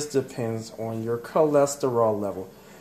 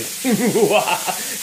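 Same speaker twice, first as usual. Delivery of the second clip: a short high laugh, louder; bright laugh; 7 semitones higher, 4 dB louder than usual. Real, not acted. Laughing at her own joke to the stray item, high. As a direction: laugh it off, pitched up.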